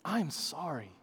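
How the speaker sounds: soft, humble plea for forgiveness; tender apology. Retold or imitated. Father asking his son's forgiveness, soft and tender. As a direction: ask him quietly, gently. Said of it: "And I said".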